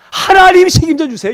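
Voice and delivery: high, loud, pestering plea; insistent demand. Acted. Moses demanding God take responsibility, loudly insistent. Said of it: "모세가 지금 떼를 쓰고 있는 거죠".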